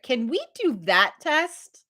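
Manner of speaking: high, slowed, rising question; pointedly drawn-out request. Retold or imitated. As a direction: nudge him toward the obvious. Retold slow questioning of doctor.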